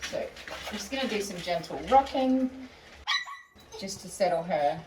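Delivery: usual speaking voice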